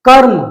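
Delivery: कर्म with र cut short, no vowel after it; कर्म, r clipped into m; half r. Shown as a demonstration. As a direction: say कर्म as karm, r joined to m. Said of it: "तो वो कैसे होगा?".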